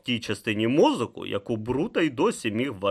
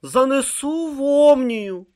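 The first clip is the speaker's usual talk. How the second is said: high, slow strained croak; hoarse croak; 7 dB louder, slower than usual. A narrator's reading. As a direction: wheeze it out slowly with effort. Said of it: "прохрипів Бруто".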